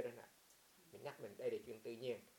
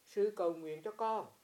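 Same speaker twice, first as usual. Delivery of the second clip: weak plea; 4 semitones higher, 7 dB louder, slower than usual. Retold or imitated. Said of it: "ông mới nói".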